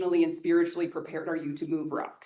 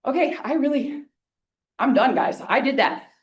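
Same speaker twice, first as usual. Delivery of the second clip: relieved, louder and higher; 7 semitones higher, 8 dB louder than usual. Real, not acted. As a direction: finish with a loud happy sigh. Relief after finishing talk.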